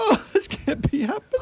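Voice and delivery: high, laughing disbelief; nervous laugh. Acted. Cornered thief Ryder laughs in panic at Holmes. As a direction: laugh through the panic of being caught.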